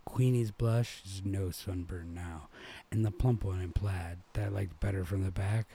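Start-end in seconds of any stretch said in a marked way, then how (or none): none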